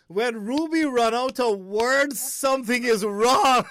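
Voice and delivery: loud, high, slow and drawn out; teasing exclamation. Real, not acted. Host teases Ruby for running out of words, teasing exclamation.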